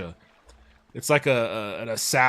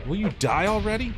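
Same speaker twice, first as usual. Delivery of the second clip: exasperated; 10 semitones higher, 3 dB louder than usual. Real, not acted. Enemy won't die.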